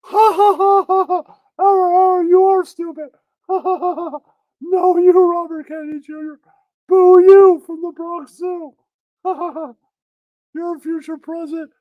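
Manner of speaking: high, loud cackling cartoon mirror; talking mirror. The mirror cackling at Robert Kennedy Junior in a high voice. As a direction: taunt like a mocking magic mirror. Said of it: "Then the mirror goes".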